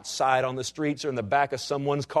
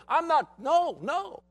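much higher, petulant refusal; stubborn Naaman refusing; slower than usual. Acted. General Naaman refusing to dip in the Jordan, in a high petulant voice. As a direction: play Naaman balking like a sulky child.

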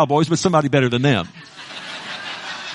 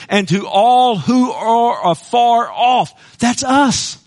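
slow, higher, ringing call; preaching shout; 5 semitones higher, much slower than usual. Real, not acted. Preacher proclaims the promise to the church, slow shout. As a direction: stretch the words out and call to the back row.

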